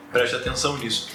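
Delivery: normal talking voice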